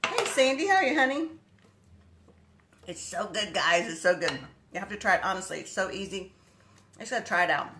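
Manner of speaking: usual speaking voice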